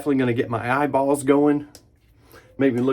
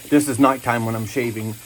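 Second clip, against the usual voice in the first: normal talking voice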